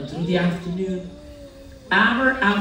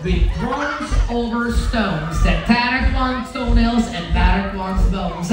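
usual speaking voice

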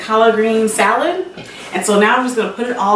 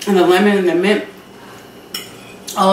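talking with a mouthful; chewing while speaking; mouth full; faster than usual. Real, not acted. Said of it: "I'm sorry I'm talking with food in my mouth".

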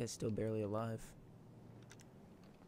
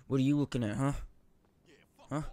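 tough-guy taunt; 3 semitones higher, 10 dB louder, faster than usual. Pretend. Confronting game character.

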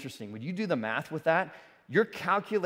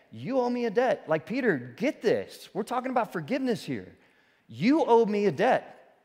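raised, earnest voice of Jesus to Peter; voicing Jesus; 7 semitones higher than usual. Preacher voicing Jesus telling Peter he owes a debt. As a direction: press the debt home, a notch higher.

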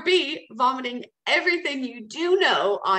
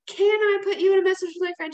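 hesitant question pitched higher and louder, "Can" leaned on; timid asking; 8 semitones higher, 5 dB louder, faster than usual. Shown as a demonstration. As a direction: ask it like you're begging permission. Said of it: "don't ask and say".

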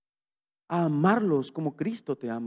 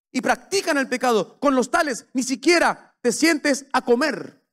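higher, louder, slowing on the warnings; preaching at full force; 6 semitones higher, 3 dB louder than usual. Acted. Preacher warning the church about God's holiness, full force. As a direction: thunder it at the congregation.

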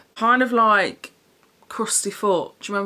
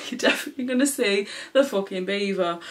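laughing; faster than usual. Real, not acted. Laughs about the see-through top.

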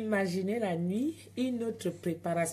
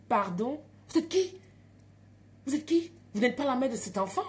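much higher, louder, incredulous; indignant retort; 5 semitones higher, 4 dB louder than usual. Retold or imitated. Mother retorting to the midwife, loud and incredulous. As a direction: throw the question back in outrage.

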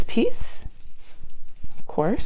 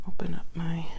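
whispering; 3 dB quieter than usual. Real, not acted. Whispered working.

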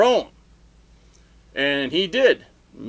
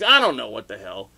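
ordinary talk

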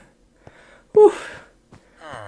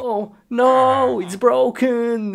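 higher, startled oh no; pitched-up dismay; dismayed surprise; 9 semitones higher, 4 dB louder than usual. Real, not acted. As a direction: react as it breaks. Something breaks in game.

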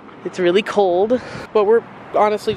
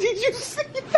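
high, laughing squeal; 4 dB quieter than usual. Real, not acted.

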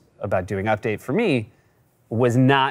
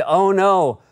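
laughing Norm quote; 7 semitones higher, much slower than usual. Retold or imitated. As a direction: crack up while quoting. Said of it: "he said".

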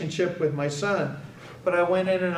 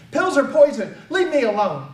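a screamed line, higher and louder, easing on the second half; retold scream; 7 semitones higher, 6 dB louder than usual. Son screams at his father in the car, refusing his medication. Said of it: "he screamed".

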